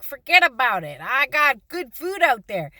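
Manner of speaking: a lightly voiced ferret brushing her off. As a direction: voice the ferret, unimpressed and dismissive.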